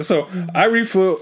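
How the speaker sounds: ordinary delivery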